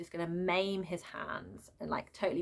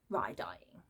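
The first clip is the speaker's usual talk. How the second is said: whisper; 8 semitones lower, 7 dB quieter than usual. Real, not acted. Reviewer mentions the death.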